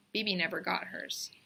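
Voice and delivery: plain reading voice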